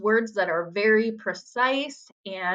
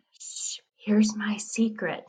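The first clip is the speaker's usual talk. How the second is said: slow whispered hush; secretive whisper; slower than usual. Acted. Teacher reads a secret-telling riddle haiku, secretive whisper. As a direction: whisper it like a secret being shared.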